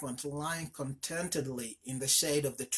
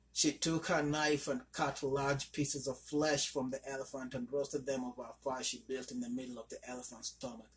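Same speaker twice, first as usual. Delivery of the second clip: ordinary delivery, nothing marked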